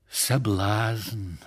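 drawn-out sigh on the word. A narrator's reading. Фомушка responds to the robbery hint, sighing. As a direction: sigh it out to nobody in particular. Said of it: "со вздохом и столь же безотносительно".

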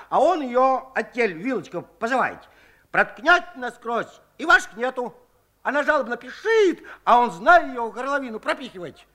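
put-on boastful old Shchukar. A narrator's reading. Old Shchukar lectures the women about oysters, bragging. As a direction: brag like you know generals.

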